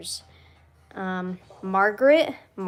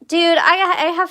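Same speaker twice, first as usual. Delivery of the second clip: excited; 9 semitones higher, 8 dB louder than usual. Real, not acted. Sudden excited burst.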